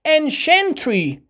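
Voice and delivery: ancientry slow and high, "en-shent-ree"; cient as "shent". Shown as a demonstration. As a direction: stretch ancientry out, "shent" in the middle. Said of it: "سی آی ای ان تی تلفظ شنت".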